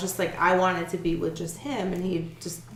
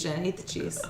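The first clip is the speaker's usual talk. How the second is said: whispering; 3 semitones lower, 9 dB quieter than usual. Real, not acted. Whispered aside to Jen.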